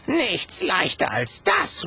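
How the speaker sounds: high, slow, breezy exclamation; drawn-out, high-voiced assurance; confident call. A narrator's reading. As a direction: toss it off with relish. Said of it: "ruft Clementia".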